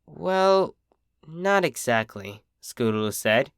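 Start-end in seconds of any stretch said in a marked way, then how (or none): 0.0-2.4 soft, slow, holding back tears; struggling to speak. A narrator's reading. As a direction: barely get the words out, close to tears. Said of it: "struggled to speak".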